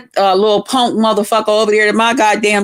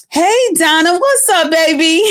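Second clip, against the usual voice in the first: mock sweet greeting; 5 semitones higher than usual. Sing-song greeting, high pitch.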